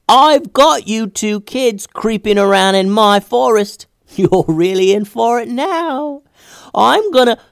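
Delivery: high, slow, taunting sprite voice; wood sprite voice. Acted. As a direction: gloat like a mischievous forest sprite. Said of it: "before the sprite could finish his sentence".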